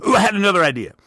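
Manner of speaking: high and loud, bursting with a sudden idea; excited idea burst. Real, not acted. Podcast host bursts out with a new business idea, excited. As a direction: blurt it out like it just hit you.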